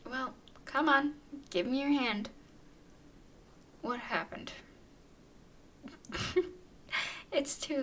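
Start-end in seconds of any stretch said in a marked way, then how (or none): 0.1-2.3 louder, insistent prompting; impatient coaxing. Acted. Repeats demand to hesitant human. 5.8-6.6 high, incredulous little laugh; amused giggle. Acted. Amused by the human's refusal.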